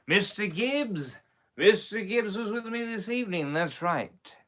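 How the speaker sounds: normal talking voice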